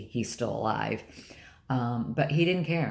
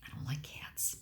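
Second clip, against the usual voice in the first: hushed whispered confession, much softer; real whispered aside to viewers; 15 dB quieter than usual. Real, not acted. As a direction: lean in and whisper it like a secret.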